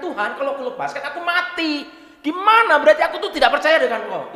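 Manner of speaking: put-on high, loud Batak-accented complaint. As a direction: argue with God in a Batak accent. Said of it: "ceritanya ikut jadi Batak". (mengeluh dengan logat Batak)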